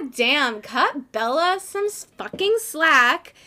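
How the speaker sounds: slow down and punch the swear, fed up; scolding, building in pitch and volume; exasperated rant. Acted.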